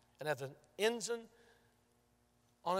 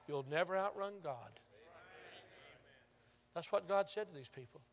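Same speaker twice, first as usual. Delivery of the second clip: ordinary talk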